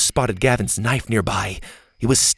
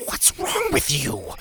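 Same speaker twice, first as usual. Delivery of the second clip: plain reading voice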